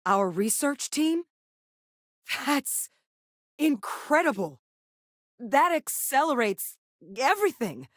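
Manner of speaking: high, thrilled disbelief; amazed excitement. Acted. Hearing the research team was found, amazed and excited. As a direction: let amazement lift the voice high.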